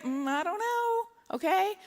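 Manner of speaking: high, drawn-out waffling; indecisive dithering. Acted. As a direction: draw it out, unable to decide. Acting out indecision over sweatpants.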